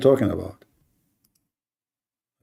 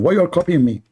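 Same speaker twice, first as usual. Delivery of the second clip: normal talking voice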